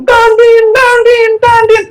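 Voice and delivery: very high, repeated honking noise; shrieky mock horn blasts; imitated car horn. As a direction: honk it out like an impatient driver. Mimics impatient drivers honking in jam.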